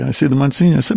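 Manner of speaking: ordinary delivery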